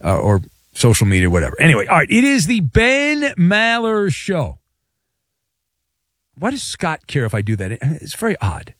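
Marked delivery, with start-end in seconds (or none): 2.1-4.6 radio show ID. Acted. Show ID announcement.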